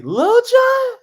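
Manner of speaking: squeaky high, loud disbelieving question; shocked disbelief. Retold or imitated. Guest retelling his amazement at the bodyguard. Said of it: "And I'm like".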